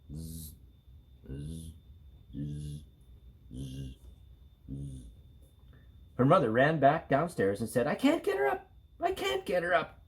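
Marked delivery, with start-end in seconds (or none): 0.1-5.1 soft low snoring. A narrator's reading. Amy asleep on the floor, soft low snores. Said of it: "went to sleep on the floor". 7.9-10.0 frantic. Acted. Frantic mother repeating.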